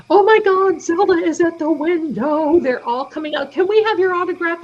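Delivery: high, loud gushing that settles a little then spikes; excited screaming. Retold or imitated. Worker running through McDonald's announcing the vlogger, excited high calling. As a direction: mimic a thrilled fan calling out. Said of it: "She's screaming".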